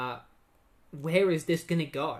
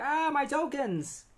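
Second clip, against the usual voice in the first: high, drawn-out mock wail of alarm; alarmed cry; 11 semitones higher, slower than usual. Max blurts out as his tokens fall, high mock cry. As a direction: yelp it in a drawn-out, panicked rush.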